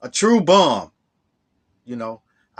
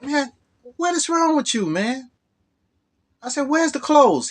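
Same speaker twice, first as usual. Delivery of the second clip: much higher, fed-up outburst; exasperated; 11 semitones higher than usual. Retold or imitated. James demanding where the clothes went, much higher exasperated. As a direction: throw your hands up in disbelief.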